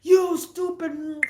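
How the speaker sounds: slow, hammed-up angry shout; mock angry yell. Boyfriend yelling at the girlfriend in the lot, a slow mock angry shout. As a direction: spit the insult slowly at her. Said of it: "starts yelling and screaming".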